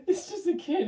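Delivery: words through high laughter; laughing. Real, not acted. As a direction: laugh while saying it. Laughing at own story.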